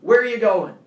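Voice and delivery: a loud, high call across the lot; cop shouting. Retold or imitated. Cop calling across the parking lot, loud shout. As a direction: bark it from far off to stop him. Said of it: "a voice goes".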